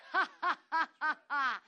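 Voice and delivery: loud, deliberate "ha ha ha"; demonstrated defiant laughter; laughing by faith. Shown as a demonstration. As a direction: force out a string of big staged laughs. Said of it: "you just gotta go".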